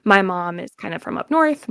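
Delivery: normal talking voice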